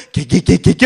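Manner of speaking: loud comic stammer on a swear word; imitated stuttered cursing. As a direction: get stuck on the first syllable, exaggerated. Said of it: "말 더듬는데도 욕 잘해요". (욕하며 더듬는 흉내)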